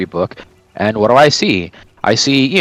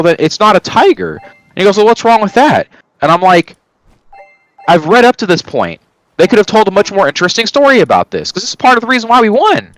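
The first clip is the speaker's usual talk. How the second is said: excited; 9 semitones higher than usual. Retold or imitated. Kid arguing with teacher.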